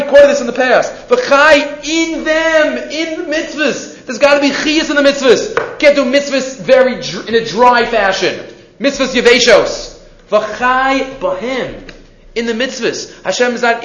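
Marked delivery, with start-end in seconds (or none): 1.1-4.0 V'chai stretched out long and high; lingering slow emphasis on V'chai. Shown as a demonstration. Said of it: "drawn out for emphasis". 10.3-11.9 V'chai bohem said very slowly; deliberate drawn-out V'chai bohem; slow emphasis. Shown as a demonstration. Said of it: "drawn out for emphasis".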